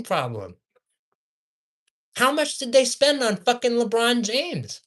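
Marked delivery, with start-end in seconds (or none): 2.2-4.8 exasperated, higher-pitched demand; getting worked up in disbelief; heated. Real, not acted. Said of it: "I'm getting heated".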